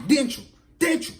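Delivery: high, sharp mock confrontation; acted angry taunting. Girlfriend confronts him at gunpoint.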